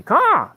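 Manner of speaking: very loud, high single caw; bird caw. Shown as a demonstration. Streamer calling at something moving in the tree, loud caw. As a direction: blast out one crow call.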